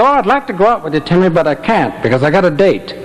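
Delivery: usual speaking voice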